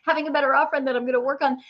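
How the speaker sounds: fast, high, breathless list of plans; frantic rushing. Retold or imitated. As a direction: rattle off plans in a frantic rush.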